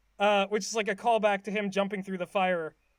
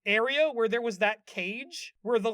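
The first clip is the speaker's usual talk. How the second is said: usual speaking voice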